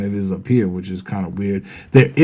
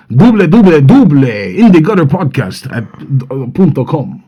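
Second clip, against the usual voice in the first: hammed-up Dominican accent ad read. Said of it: "my Dominican voice".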